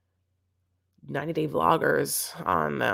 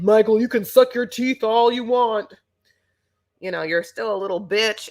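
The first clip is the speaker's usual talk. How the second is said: loud, higher-pitched mocking impersonation; Kimberly; 5 semitones higher, 5 dB louder than usual. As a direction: throw it out as a loud taunt, easing off at the end. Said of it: "Kimberly saying".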